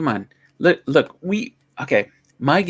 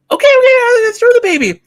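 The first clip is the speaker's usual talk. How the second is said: very high, loud falsetto; mock panicked woman; 8 dB louder than usual. Mother at window agreeing to throw, high loud mock panic. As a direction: give in with a put-on frantic cry.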